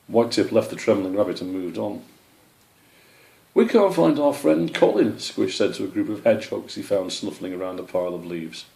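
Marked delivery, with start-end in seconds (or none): 3.5-5.2 high, louder anxious plea; worried young squirrel. Acted. Squish tells the hedgehogs they lost Colin, high and worried. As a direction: plead for help.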